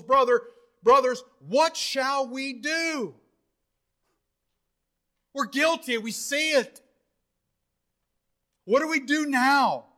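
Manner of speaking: high, urgent cry of guilt; convicted crowd pleading. Retold or imitated. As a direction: plead desperately as the stricken listeners. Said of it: "they were cut to the ear-, to the heart".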